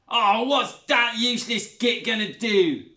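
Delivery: slow sneer at the useless father. A narrator's reading. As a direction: sneer with contempt. Mocks Zoe's father.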